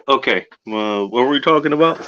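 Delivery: normal talking voice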